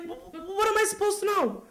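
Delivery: high, stammering bewilderment; sputtering mock indignation; flustered father. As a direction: stumble into the question, pitch jumping up.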